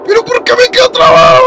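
very high, loud shriek; panicked scream. Real, not acted. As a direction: scream it in desperate frustration. Stuck in game while chased. (chillido agudo de desesperación)

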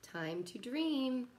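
slow, gentle storybook read with long pauses; reading to children. Acted. Teacher reading a bedtime picture book to kids, slow and gentle. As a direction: lull the little ones toward nap time.

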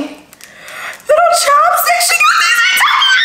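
very high, shouted squeal of delight; overjoyed squeal. Real, not acted. Vlogger finding chopsticks from a friend, overjoyed squeal. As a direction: shriek it out, thrilled by the gift. Squealing over a gift from Tokyo.

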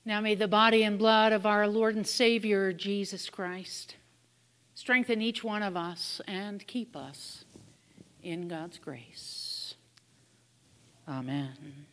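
prayer. Acted. Gives a benediction.

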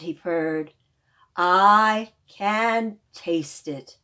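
the lion answers the animals in a slow loud purr. Acted. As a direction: purr each line like a big contented lion. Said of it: "The lion spoke in a loud purr".

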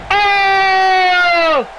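drawn-out commentator's "ohhh"; long excited "oh". Acted. Commentator reacts to a move in the ring, excited cry. Reacts to leg across throat.

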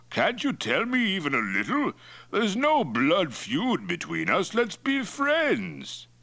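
high, expansive chief's voice, slowing down; Mongol chief Toktai, cajoling. Acted. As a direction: coax him like a hearty chief, stretching the end.

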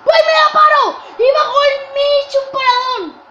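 high, loud triumphant yelling; excited shout. Real, not acted. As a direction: crow about the save. Celebrating his own save.

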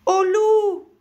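high, full-force scream of warning; hurlement d'alarme. A narrator's reading. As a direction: yell it out long and loud. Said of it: "hurla alors le petit lapin". (hurlement aigu à pleine voix)

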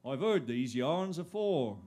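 normal talking voice

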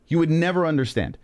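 normal talking voice

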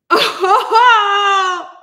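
loud, high, crying oh; dismayed shout. Real, not acted. Streamer realizing her mic was off, a high dismayed cry. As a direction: yelp it out as it dawns on you.

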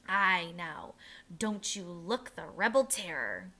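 plain reading voice

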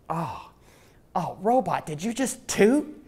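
high, slow playful gasp of surprise; mock shocked. Teasing robot about toot.

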